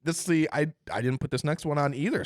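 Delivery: usual speaking voice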